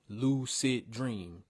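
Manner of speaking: very slow "Lucid dream", each syllable spaced. Shown as a demonstration. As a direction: tap out the three syllables. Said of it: "Three syllable".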